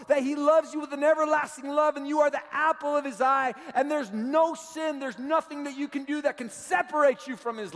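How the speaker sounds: high, loud impassioned sermon shout; rousing, high-pitched proclamation to the congregation; full-force preaching. Real, not acted. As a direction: preach it at the top of your voice.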